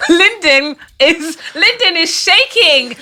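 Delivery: high, slow words through real laughter; laughing. Real, not acted. Friend laughs at the producer shaking over the N-word. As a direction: giggle it out.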